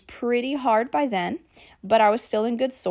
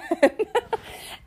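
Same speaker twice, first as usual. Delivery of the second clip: breathy laugh; 12 semitones higher than usual. Real, not acted. Laughs after her outburst.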